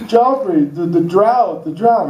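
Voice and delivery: high whiny mock panic; panicky neighbors. Neighbors wailing to Joffrey about the drought, high and panicky. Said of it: "They're like".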